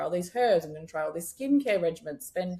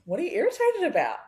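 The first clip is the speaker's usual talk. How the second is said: surprised; 7 semitones higher, 4 dB louder than usual. Retold or imitated. Surprised reply to her skin.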